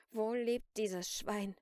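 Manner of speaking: slow, loud, seething demand; through gritted teeth. A narrator's reading. Celine demands the artist's address, through gritted teeth. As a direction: force each word out through gritted teeth. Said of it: "presste Celine durch die Zähne".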